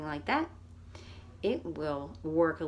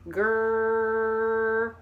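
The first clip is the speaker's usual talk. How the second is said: long stretched grr, louder; lengthened grr; 5 dB louder than usual. Shown as a demonstration. Speech teacher stretching the grr for kids. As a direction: hold the grr sound long. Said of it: "we're lengthening, pausing, and lengthening the vowel".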